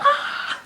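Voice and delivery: very high laughing mock shriek; retold scream. Retelling the friend's scream, high and laughing. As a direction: shriek it while cracking up. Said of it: "It was freaking funny".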